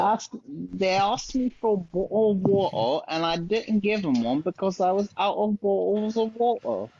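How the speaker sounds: high-pitched, hammed-up British accent with glottal "bottle of water"; bad British accent. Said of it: "your accent is absolutely horrible".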